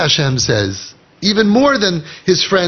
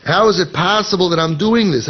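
usual speaking voice

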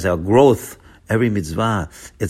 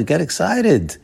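excited urging; 8 semitones higher, slower than usual. Shown as a demonstration. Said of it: "to be wildly excited".

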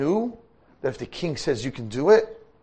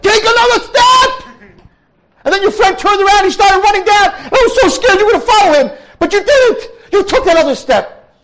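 very high, very loud urging; excited cheering; 16 dB louder than usual. A narrator's reading. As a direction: cheer him up the last step. Princess retells cheering him on.

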